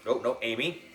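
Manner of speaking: plain reading voice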